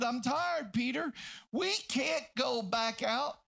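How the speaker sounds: high, worked-up protest; exasperated pleading. Acted. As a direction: plead like a worn-out fisherman refusing to return. Acts out tired crewman pleading.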